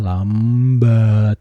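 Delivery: slow loud lambat. Shown as a demonstration. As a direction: drag out lambat loudly. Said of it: "tulisannya tidak seperti itu".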